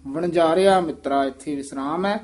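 ordinary talk

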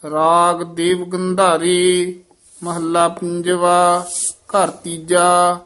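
slow, measured Gurbani recitation; scripture recitation. Acted. Teacher reciting Gurbani for learners, measured chant-like reading. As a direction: hold the long vowels, pausing between phrases.

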